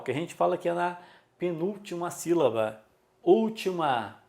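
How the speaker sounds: ordinary talk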